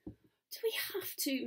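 high, soft reluctant whine; moaning. A narrator's reading. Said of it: "moans Tooty".

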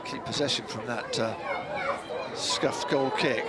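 normal talking voice

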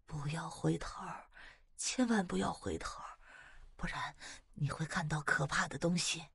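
soft, ghostly near-whisper; eerie hushed voice. A narrator's reading. Unseen voice warning mother not to look back, eerie hush. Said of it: "突然听到一个声音".

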